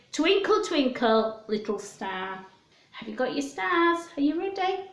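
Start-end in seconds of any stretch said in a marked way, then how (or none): none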